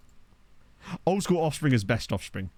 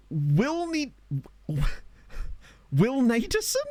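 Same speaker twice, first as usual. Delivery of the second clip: high, slow, drawn-out questioning of the name "Will Naderson?"; puzzled sounding out; 9 semitones higher, much slower than usual. Pretend. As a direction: stretch "Will Naderson?" out high, unsure how to say it.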